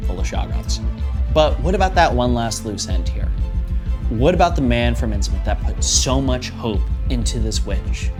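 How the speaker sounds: ordinary talk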